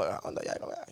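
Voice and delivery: very low, soft mumble; barely voiced retold babble; demonstrated way of talking. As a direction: imitate your own hushed, low video voice. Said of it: "comencé a hablar así, como".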